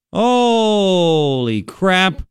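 very slow, drawn-out holy crap; amazed. Real, not acted. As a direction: stretch it out in disbelief. Stunned by trade news.

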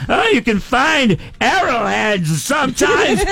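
high, drawn-out gushing squeal; mock excitement. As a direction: mimic overexcited metal-detector hobbyists. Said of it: "get all excited".

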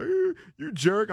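high, whiny fake sobbing; mock crying. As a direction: whine it like a fake crybaby. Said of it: "steadily crying at me like".

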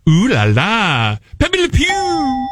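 slow, hammed-up French accent, rising high. Shown as a demonstration. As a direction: draw it out in mock French. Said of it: "is French".

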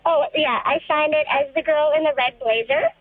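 ordinary talk

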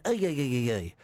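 lightly done mock Irish accent, ay ay ay; a playful, half-hearted Irish brogue. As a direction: ham up a quick Irish accent for Saint Patrick's. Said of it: "That was my Irish accent".

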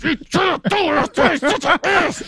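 embarrassed friend scolding without moving her lips; ventriloquist scold. Retold or imitated. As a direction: hiss it through a frozen grin. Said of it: "She goes".

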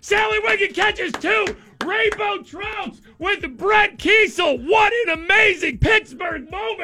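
very high shouted commentary; mock ecstatic sports call. Host celebrates angler's two trout, mock ecstatic commentary. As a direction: scream it like a championship-winning call.

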